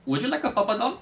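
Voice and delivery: high, put-on Indian accent; Indian accent attempt. Shown as a demonstration. Trying out accents for a teasing friend, high Indian accent. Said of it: "Indian accent?".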